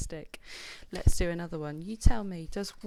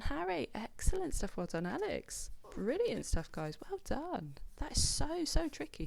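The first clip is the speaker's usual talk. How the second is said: bright praise; 8 semitones higher than usual. Real, not acted. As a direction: enthusiastic teacher praise. Praising children's answers.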